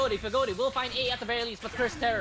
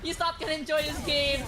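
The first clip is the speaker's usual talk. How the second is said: excited; 6 semitones higher than usual. Real, not acted. Caster reacts to a big play.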